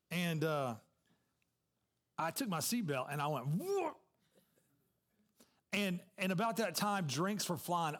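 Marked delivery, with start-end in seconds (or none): 3.5-4.0 high swooping "whoa"; sudden jolt of alarm, acted out; startled whoa. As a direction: voice leaps way up. Acts out his alarmed reaction.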